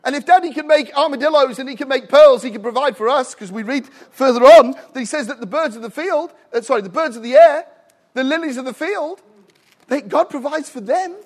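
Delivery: loud, high revival preaching; preaching at full force. Real, not acted. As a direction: proclaim it to the congregation.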